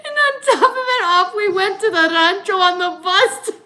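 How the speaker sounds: high, fast, tearful laughter in the voice; laughing hard. Real, not acted. Telling a travel sickness mishap, laughing hard. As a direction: tell it while cracking up.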